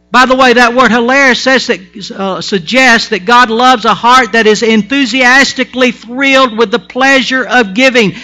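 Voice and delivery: loud, high, rousing pulpit voice; full-force preaching. Acted. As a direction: whip the congregation up with joy.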